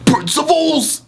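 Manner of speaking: very high, slow strained shout; hammed-up Prince of Saiyans yell; Vegeta voice. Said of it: "I can't do it right now".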